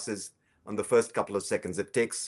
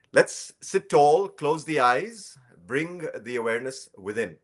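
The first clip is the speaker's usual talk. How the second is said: unhurried cues with long pauses; guided breathing meditation; 3 semitones higher, 6 dB quieter than usual. Shown as a demonstration. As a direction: gently lead them through each breath, leaving space. Leads guided breathing practice.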